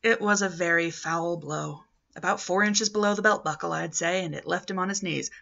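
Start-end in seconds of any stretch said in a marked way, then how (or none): none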